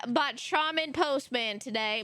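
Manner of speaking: ordinary talk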